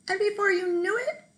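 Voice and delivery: ordinary delivery, nothing marked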